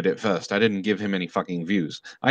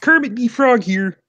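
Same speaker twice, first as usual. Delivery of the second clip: much higher put-on frog puppet voice; Kermit the Frog; 13 semitones higher, 4 dB louder than usual. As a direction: announce yourself in a high puppet-frog impersonation. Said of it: "to say as Kermit".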